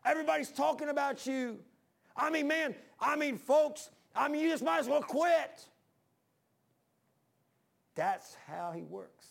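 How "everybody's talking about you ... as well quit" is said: high, loud jeering devil voice, pushing hard; taunting devil. Retold or imitated. Preacher voicing the devil taunting a churchgoer, loud high jeering. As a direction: yell the lies like the devil breaking your spirit. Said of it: "He'll say".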